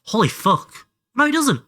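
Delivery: shocked realisation; embarrassed shock. Real, not acted. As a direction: caught out in a blunder. Realises geography mistake.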